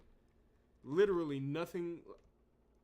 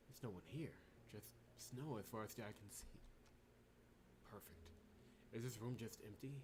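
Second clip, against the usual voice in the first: soft, low, uneasy in-character reading; game protagonist voice; 6 semitones lower, 12 dB quieter than usual. Acted. Player voicing the heroine exploring a frozen floor, soft and uneasy. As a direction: voice the wary hero exploring the floor.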